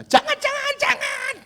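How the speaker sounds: high mock scream of no, no, no; girl's scream. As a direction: shriek it like a frightened girl. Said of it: "kamu teriak ya nak ya".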